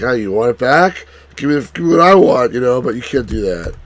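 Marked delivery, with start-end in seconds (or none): 0.0-2.5 taunting demand, higher and louder; mock bargaining. Player imagines haggling with librarian over claim check, mock taunting demand. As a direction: dangle it and push for a trade. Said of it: "basically, be like".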